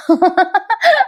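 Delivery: high, rising real giggle; real tickled laugh; amused laughing. Real, not acted. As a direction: burst into a light laugh. Amused at the teasing.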